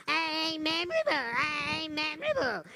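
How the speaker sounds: put-on retelling a child's happy shouting; mild mock scream. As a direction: echo the girl's happy chant, lightly. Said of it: "screaming at the top of the lungs".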